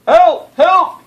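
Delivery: high, loud cry for help; urgent radio call. Acted. Policeman calling the firemen on the radio, loud and high. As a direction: sound the alarm over the radio.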